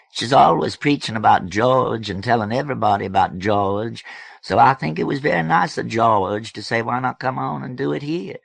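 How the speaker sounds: high, loud South Alabama twang; Plump Pink's South Alabama accent. A narrator's reading. Plump Pink praising George's offer, South Alabama accent. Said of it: "identify as South Alabama Flats".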